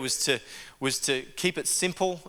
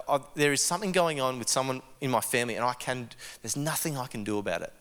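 quiet despair; 5 semitones lower, 5 dB quieter, faster than usual. Retold or imitated. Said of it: "she was just sharing".

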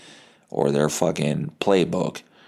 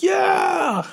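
high-pitched mock crowd yell; crowd cheer; 3 dB louder than usual. Crowd answering the megaphone girl, imitated cheer. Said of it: "everyone was like".

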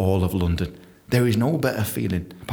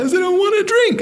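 nervous squeak; 6 dB louder than usual. Retold or imitated. Nervous offer of a round.

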